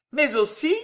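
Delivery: lightly acted nonchalance; nonchalant. A narrator's reading. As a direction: toss it in nonchalantly. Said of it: "d'une voix nonchalante".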